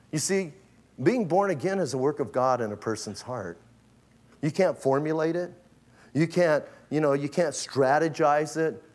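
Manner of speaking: ordinary talk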